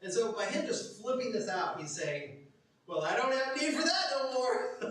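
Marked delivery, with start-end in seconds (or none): 2.9-4.8 high, loud carefree dismissal; gleeful confidence. Acted. Beggar flips away his begging cloak, gleeful confidence. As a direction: toss it off exuberantly.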